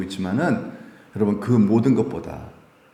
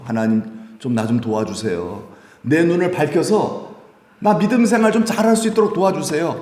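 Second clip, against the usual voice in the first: humble prayer; 5 semitones higher than usual. Retold or imitated. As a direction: beg God for help, growing urgent. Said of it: "겸손히 기도하라는 거죠".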